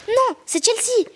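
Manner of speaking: high, drawn-out cry of "no"; slow, high-pitched acted outcry; urgent protest. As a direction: call out to stop someone. Stops uncle catching the dolphin.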